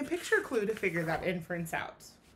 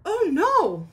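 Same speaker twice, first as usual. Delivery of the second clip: high, loud oh no; alarmed cry; 6 semitones higher, 9 dB louder than usual. Acted. Kevin to himself as the tree shakes, alarmed. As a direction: gasp it out in alarm.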